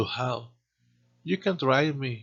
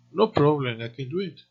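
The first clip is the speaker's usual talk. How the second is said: CAN passed over lightly, other words accented; unstressed CAN; 2 semitones higher, 3 dB louder than usual. Shown as a demonstration. Teacher shows the normal positive phrase, no stress on CAN. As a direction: leave CAN weak and stress the words around it. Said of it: "Hacemos toda la frase normal sin acentuar can".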